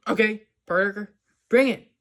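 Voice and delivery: loud imitated bully challenge; mock challenge shout. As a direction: call him out like a jock, loud. Flash challenges Peter.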